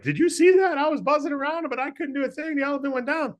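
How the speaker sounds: very high, buzzy little mosquito voice. Acted. Mosquito bragging to the elephant, in a very high excited voice. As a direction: squeak it out like a tiny bug. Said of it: "the mosquito's like".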